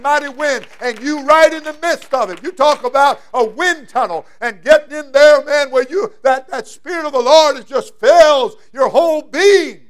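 loud, high, ringing sermon delivery; full-force preaching. Acted. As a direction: preach it out to the whole room.

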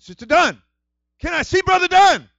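high, loud call at the door. Retold or imitated. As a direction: call through the door to the sister. Calling at the sister's door.

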